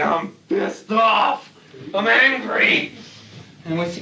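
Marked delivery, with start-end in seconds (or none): 0.0-2.9 higher, very slow mock angry reply; young guy's anger. Young pilot telling the interviewer he is angry, mock anger. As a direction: drag it out like a sore young pilot. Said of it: "the young guy would say".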